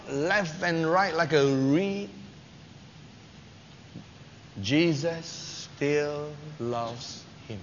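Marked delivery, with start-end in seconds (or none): 4.6-7.6 slow, soft and tender. Real, not acted. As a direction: let it land gently, almost hushed.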